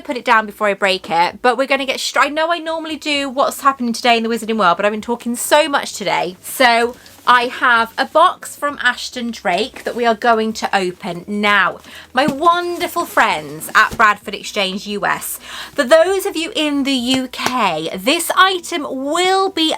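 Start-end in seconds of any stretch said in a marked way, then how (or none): none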